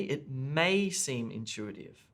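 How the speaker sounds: slow phrase with "may" drawn out and emphasised; stress on MAY. Shown as a demonstration. Teacher shows test takers the emphasised modal verb, stress on "may". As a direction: lean long on "may", lighter on "seem intuitive". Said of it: "the modal verb may".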